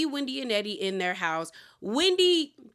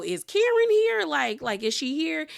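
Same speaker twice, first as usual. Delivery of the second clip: eager gossip question; 7 semitones higher than usual. Retold or imitated. Ashley asking the staff about Karen, high eager voice.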